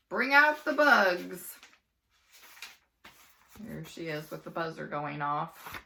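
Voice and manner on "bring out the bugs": louder, slow host announcement; game show host calling out; announcing. Acted. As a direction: call for the bugs like a showman.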